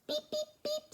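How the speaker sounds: high, toy-horn beeps; high playful beeping; truck horn beep. Acted. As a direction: honk it out like a little truck. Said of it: "says Little Blue".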